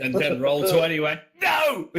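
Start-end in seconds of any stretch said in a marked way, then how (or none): 1.4-1.9 mock yell. Game voiced as refusing roll.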